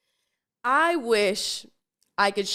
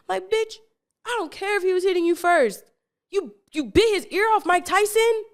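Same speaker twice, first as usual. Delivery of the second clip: higher, incredulous telling-off; exasperated scolding; 10 semitones higher than usual. Real, not acted. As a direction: scold her in disbelief. Scolding the girl who bit ear.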